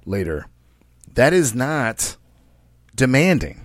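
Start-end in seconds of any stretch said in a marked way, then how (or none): 0.0-0.5 low, soft, quick matter-of-fact heads-up; lower, softer casual warning; calm news report. Retold or imitated. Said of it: "just reporting the news like".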